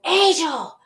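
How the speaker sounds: high-pitched retold call; imitated calling out. Friend calling to her in the water, high imitated call.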